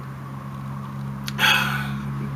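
long heavy exhale; real drawn-out sigh; weary sigh. Real, not acted. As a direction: let out a long breath. Weary after telling of the death.

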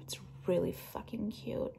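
unvoiced whispered aside; a secret little whisper to the viewers. Real, not acted. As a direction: drop to a hushed whisper.

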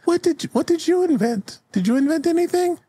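put-on very high little-kid voice; squeaky-high eager question; kid's voice. One of the kids questions old Pork, high child voice. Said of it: "one of the kids raises their hands".

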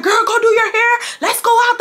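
high, yelling Wesley; hammed-up yell at her; mock yell. Wesley yelling at her in the house, high mock yell. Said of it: "he's getting loud".

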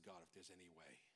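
very soft, low plea; hushed prayer. Retold or imitated. Pastor recalling his plea to God, hushed. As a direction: breathe the prayer almost silently.

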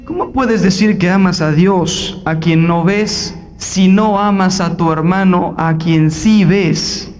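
más grave y lento, leyendo la carta; cita solemne. Acted. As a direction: lee la Escritura, lento y grave. Cita la carta de San Juan. (el predicador lee a San Juan, lento y grave)

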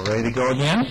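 ordinary delivery

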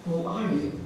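soft answering echo. A narrator's reading. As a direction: repeat it quietly, like a distant echo. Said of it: "receive the same echo".

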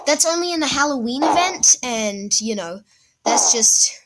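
normal talking voice

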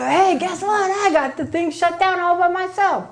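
high, bright boast of triumph; proud bragging. Retold or imitated. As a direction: crow about your big win. Bragging on phone to Bo.

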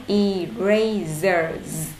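"Erasers" stretched out very slow; read slowly. Shown as a demonstration. Teacher slowing down for struggling pupils. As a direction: drag "Erasers" out syllable by syllable. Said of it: "Cô đọc chậm lại".

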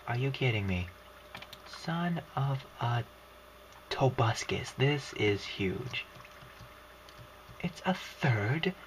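normal talking voice

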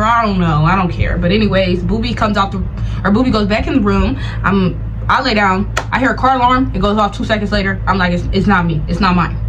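normal talking voice

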